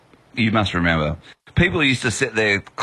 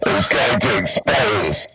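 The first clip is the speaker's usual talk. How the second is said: slow, ominous masked villain; menacing, drawn-out villain monologue; slower than usual. Pretend. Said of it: "I'll do my sound effect".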